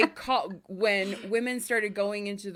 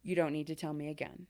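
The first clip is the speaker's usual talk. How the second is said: calm reassurance; 5 semitones lower, 9 dB quieter than usual. Retold or imitated. Calming husband with set phrase.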